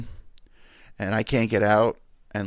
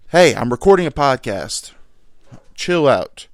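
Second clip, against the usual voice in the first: yelling at dogs; 4 semitones higher, 10 dB louder than usual. Real, not acted. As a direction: shout them down from across the room.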